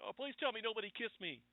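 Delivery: high gasp into a high, panicky plea; hammed-up hero waking with a start; startled Tony Stark. Tony Stark waking after the fall, startled high gasp. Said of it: "And then he goes".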